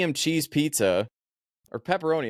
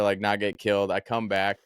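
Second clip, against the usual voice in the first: ordinary delivery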